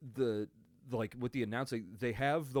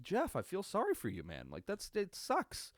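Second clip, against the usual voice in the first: mock sympathy; 9 semitones higher than usual. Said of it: "It's like".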